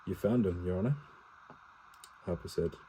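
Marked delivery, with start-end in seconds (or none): none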